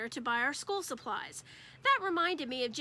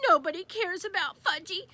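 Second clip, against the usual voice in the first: mild crying whine; 5 semitones higher, 4 dB louder than usual. Acted. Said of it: "Fudge started to cry".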